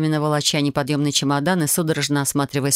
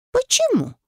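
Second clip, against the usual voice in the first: high, suspicious query; alarmed suspicion. A narrator's reading. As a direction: ask sharply upward, suddenly on guard. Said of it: "Тут же насторожилась она с подозрением".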